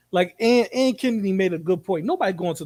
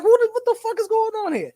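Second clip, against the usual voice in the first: much higher, incredulous what-the-fuck; imitated outrage; 3 dB louder than usual. Streamer voices G-Man's reaction to inspector calls, high incredulous outburst. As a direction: sound suddenly thrown and fed up.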